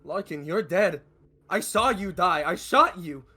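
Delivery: high, very loud disbelief; frantic shouting. Acted. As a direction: shout it in panic at a dead man. Confronts man he killed.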